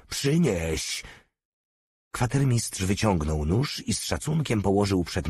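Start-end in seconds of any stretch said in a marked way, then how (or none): 0.1-1.0 the repeated przynieś pitched higher, barked as an order; commanding. A narrator's reading. Malutki repeats the order to the quartermaster, higher and mocking. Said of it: "rozkazał Malutki".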